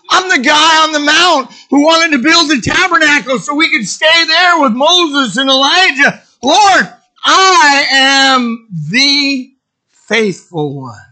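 high, loud, indignant declaration; boastful proclamation. Acted. Peter boasting to the Lord of his loyalty, loud grand protest.

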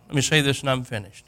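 normal talking voice

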